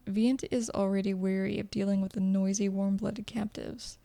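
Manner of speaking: plain reading voice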